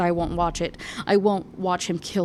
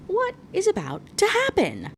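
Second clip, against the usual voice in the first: very high, giddy disbelief; suspenseful excitement; 13 semitones higher than usual. Real, not acted. Reader to listeners before the final chapter, excited squeal. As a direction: squeal the question in anticipation.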